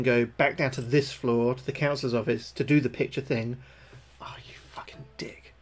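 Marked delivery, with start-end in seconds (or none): 4.2-5.5 very soft, voiceless swearing; defeated whisper; whispered cursing. Real, not acted. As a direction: curse the game under your breath. Dismayed by game setback.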